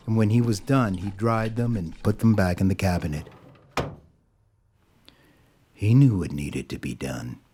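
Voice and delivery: ordinary delivery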